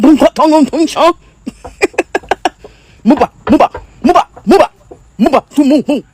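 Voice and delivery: loud, hammed-up fake African accent; mock African accent. Mocks the idea that Americans should sound African, fake African accent. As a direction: caricature an African accent with punchy nonsense syllables. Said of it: "with some type of accent".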